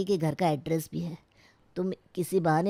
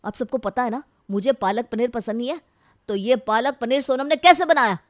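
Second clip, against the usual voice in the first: indignant, building to a loud, higher demand; angry outburst; 4 semitones higher, 6 dB louder than usual. Acted. Husband demanding why palak paneer was cooked, angry. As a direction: let the anger build to the last words. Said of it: "देखते ही भड़क जाता है".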